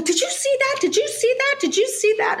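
high, rapid-fire amazed pleading; grabbing the neighbours, did they see it too; excited disbelief. Retold or imitated. Passenger to seatmates after the glass tips itself, high amazed pleading.

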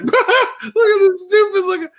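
squealing high through laughter; can barely get it out for laughing; high giggling mock of the cat; laughing quote. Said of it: "and I'm just like".

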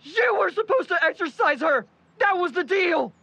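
high, hard accusing shout; angry accusation. Acted. Dean blaming Rhea and Patrick, angry shout. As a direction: hurl blame at them.

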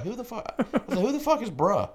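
shocked outrage. Retold or imitated. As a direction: squeal it out in disbelief.

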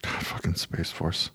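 whispered, exasperated; whispered curse. Real, not acted. Podcaster whispering his frustration at Space Force. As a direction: breathe it out under the breath, fed up.

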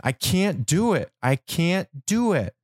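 high protest, drawn out on the last repeat; retold yelling. Dreamer refusing to put the dog down, acted high yell. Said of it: "I yell again".

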